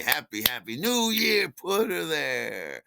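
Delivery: high, loud, drawn-out holiday cheer; exuberant greeting. Retold or imitated. Greeting Steve so he meets his eyes, over-the-top cheer. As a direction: blare it into his face, stretching the last words. Same trick greeting to Michael.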